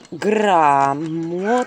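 very slow drawn-out грамота; slowly spelled out. Acted. Heroine slowly writing грамота on birch bark. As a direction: say грамота slowly as you write it.

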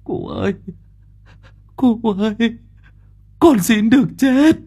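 high, tearful begging voice; begging through tears to be let die; whimpering plea. A narrator's reading. Young man begs old Diệp, high tearful whimper. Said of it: "nó mếu máo".

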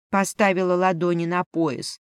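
ordinary delivery, nothing marked

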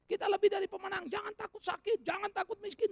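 high, loud, chant-like mockery; mock triumphant singer. Preacher mocking churchgoers' boastful singing, high and loud. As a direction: parody people belting a victory song. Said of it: "orang nyanyi pada tahu apa enggak".

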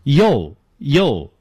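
drawn-out "yo" in place of the letter U; wrong pronunciation. Shown as a demonstration. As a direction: say "yo" slowly, as the common mistaken U. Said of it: "错误的发成".

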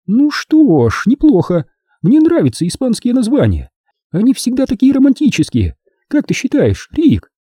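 high-pitched feminine character voice; calmed-down woman. A narrator's reading. Woman warms to Spanish names, asking his opinion, appeased.

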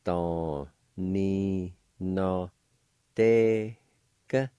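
each syllable apart with pauses. Shown as a demonstration. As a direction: break the word into syllables. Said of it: "It's pronounced like this".

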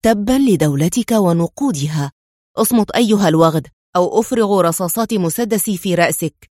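ordinary delivery, nothing marked

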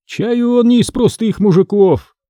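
high-pitched, acted musing aloud; cheeky remark. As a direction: size up the guest aloud, unimpressed. Кузнец дерзко рассуждает о госте. (дерзко, высоким голосом)

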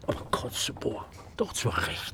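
soft unvoiced aside; hushed sly remark; conspiratorial whisper. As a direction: lean in and whisper it. Conspiratorial aside about the dead.

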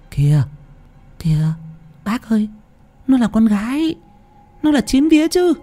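high, quiet voice, a little hesitant; softly correcting woman. Acted. Woman softly reminding the chanter the child is a girl, high and quiet. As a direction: gently point out the mistake.

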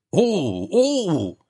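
drawn-out high ooh, twice; long exclaimed "oo". A narrator's reading. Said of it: "İçeriden Fyodor'un sesi geldi". (uzatılmış, tiz "oo" nidası)

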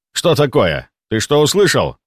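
plain reading voice

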